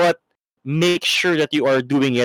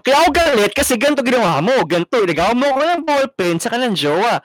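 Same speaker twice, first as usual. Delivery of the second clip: high, fast, louder whiny rant; angry complaint; 9 semitones higher, 4 dB louder, faster than usual. Shown as a demonstration. Friend shows the wrong way to voice anger, a high fast rant. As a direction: blurt out the grievance in a squeaky rush. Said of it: "Oh, parang".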